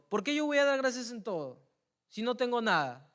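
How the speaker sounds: loud, high indignant protest; angry retort. Retold or imitated. Boy protesting angrily to his Sunday school teacher. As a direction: snap back at the teacher in outrage.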